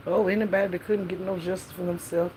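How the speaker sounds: ordinary talk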